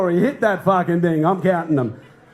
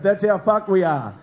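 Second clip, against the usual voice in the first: ordinary talk, nothing marked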